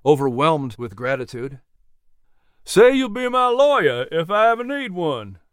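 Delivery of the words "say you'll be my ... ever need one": high, playful drawn-in tease; teasing. A narrator's reading. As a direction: tease him as if with a wink. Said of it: "Jack teased with a wink".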